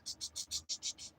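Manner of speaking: soft unvoiced clicking; imitated dial clicks. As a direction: tick off the dial's return. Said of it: "it's like".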